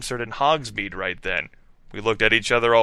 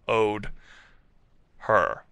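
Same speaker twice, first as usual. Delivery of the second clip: ordinary delivery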